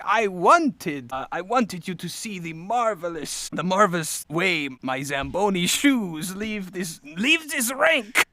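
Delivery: put-on grandiose French-accented villain, slow and building; French villain proudly explaining his scheme. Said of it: "as he's sort of explaining himself".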